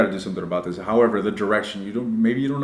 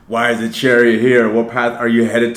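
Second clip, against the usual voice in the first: asked again, louder and more baffled; louder surprised question; 7 dB louder than usual. Real, not acted. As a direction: really wonder aloud why the card turned up. Re-asks the card question louder.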